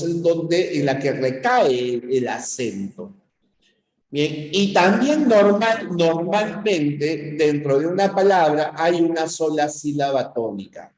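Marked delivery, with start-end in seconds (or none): none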